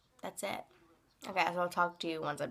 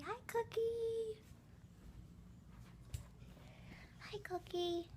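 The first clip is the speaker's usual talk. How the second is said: high, slow, sweet talk to a dog; pet voice; 13 semitones higher, 8 dB quieter, slower than usual. Real, not acted. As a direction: coo at the dog. Greeting the dog Cookie.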